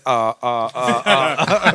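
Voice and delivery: flustered halting uh uh uh, mock; stammering uh uh. A stunned BBC man stammering at a rapper. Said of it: "He's just like".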